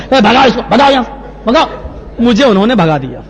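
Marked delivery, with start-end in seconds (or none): 0.1-1.7 mock angry dismissal. Ordering him chased away.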